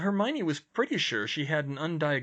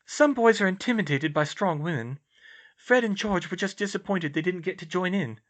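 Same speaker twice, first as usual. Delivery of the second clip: higher-pitched character voice, earnest; raised, sincere dialogue voice; earnest young heroine; 8 semitones higher, 5 dB louder than usual. Acted. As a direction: voice the concerned friend, pitched up. Hermione defends herself.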